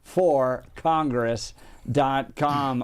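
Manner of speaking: slow, separated letters and words; spelling it out. Real, not acted. Radio host giving out a candidate's web address, spelled slowly.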